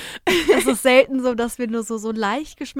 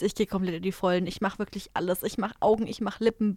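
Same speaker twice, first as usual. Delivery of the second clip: ordinary delivery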